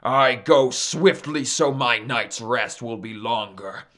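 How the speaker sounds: indignant sun god's voice; demanding. Acted. Sun challenges Maui's terms, demanding and indignant. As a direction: start contained, then build to a loud demand. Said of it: "Sun demanded".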